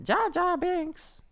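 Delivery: high, slow goofy creature; Jar Jar Binks voice. Acted. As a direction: squeak it like Jar Jar. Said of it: "Is that accurate?".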